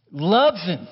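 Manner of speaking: ordinary delivery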